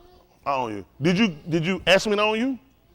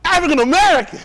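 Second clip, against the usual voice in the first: higher, louder eager reply; mock answer; 8 semitones higher, 8 dB louder than usual. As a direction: blurt out the proud answer. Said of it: "What you, what you is, boy?".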